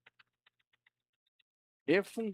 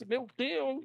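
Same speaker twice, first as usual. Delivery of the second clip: mock surprise; 11 semitones higher than usual. Surprise party guest reacts.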